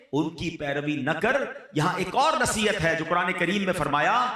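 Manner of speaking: ordinary delivery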